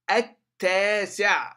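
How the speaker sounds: "التاسع" loud, as at-taasi' with doubled t; silent lam. Shown as a demonstration. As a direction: hold the ت in "التاسع", no l. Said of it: "تختفي اللام". (نطق "التاسع" بتاء مشددة دون لام)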